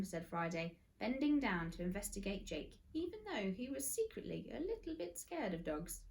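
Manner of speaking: usual speaking voice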